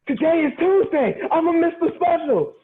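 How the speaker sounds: very high, hammed-up wail; imitating a hero's comic panic; panicked realization. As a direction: cry it out as if disaster just struck. Retold hero realizes he's missing sale.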